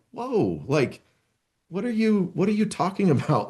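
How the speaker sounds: high, incredulous whoa with a laugh; baffled. Acted. Colleague baffled by a needless apology, laughing in disbelief. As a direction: sound thrown by the reaction.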